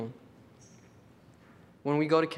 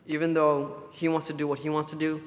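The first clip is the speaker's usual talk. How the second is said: usual speaking voice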